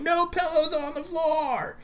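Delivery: high-pitched, drawn-out wail of a fed-up parent; exasperated yell. Retold or imitated. Dad who can't take it anymore re-enacts his outburst to the kids, exasperated high yell. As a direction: cry it out slowly, way up high, at the end of your patience.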